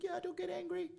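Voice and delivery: high, sheepish mom voice; a pleading little mom squeak; imitating mom. Son retells mom answering about her facelift, high sheepish voice.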